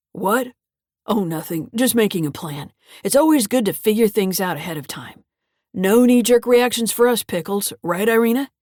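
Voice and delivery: a hint of a rambling old man, rising on Irina; lightly voiced confused grandfather. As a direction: slip into the muddled grandpa, gruff but absent.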